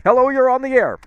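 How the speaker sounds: host picking up the next caller, bright announcer read; radio host greeting. Acted. Radio host taking a call.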